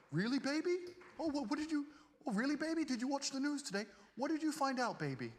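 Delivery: high, fawning mock girlfriend voice; simpering girlfriend. Mocking women who fawn over a rich man, high fawning coo. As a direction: coo it like a fake adoring girlfriend. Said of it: "they sit there and go".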